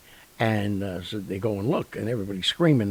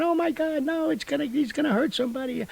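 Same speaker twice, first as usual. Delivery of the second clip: mock screaming. Filming family watches the shark fight, screaming in mock panic. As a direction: imitate panicked onlookers in a squealing falsetto. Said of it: "they're screaming".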